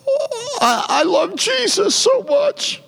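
overdone crocodile-tears voice of a Hollywood actress; mock tearful actor. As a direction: fake a teary, trembling confession of love. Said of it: "cry or weep and, uh, have a quivering lip and say".

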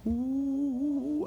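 high, drawn-out ooh climbing with excitement; excited rising "ooh". Retold or imitated. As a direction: let a long high hoot swell upward. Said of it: "I started getting excited".

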